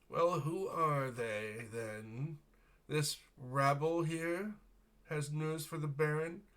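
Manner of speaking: slow, high, sneering noble voice. Acted. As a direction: look down your nose and drag the words out slowly. Sneering noble drawls a question.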